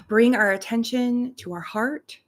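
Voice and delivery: unhurried meditation voice with long pauses between phrases; guided meditation. Real, not acted. Reverend leading listeners into their hearts and breath, guided meditation. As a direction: guide them gently into breath and gratitude, leaving space after each phrase.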